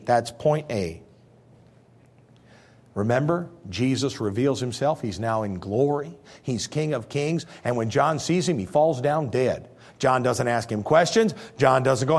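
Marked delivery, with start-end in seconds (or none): none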